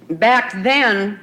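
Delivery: usual speaking voice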